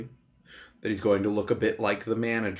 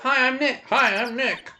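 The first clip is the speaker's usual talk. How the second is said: high-pitched test greeting, held back from a shout; not loud enough; 13 semitones higher than usual. Acted. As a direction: raise the pitch, announce yourself to a microphone. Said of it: "Maybe I'm not saying it loud enough".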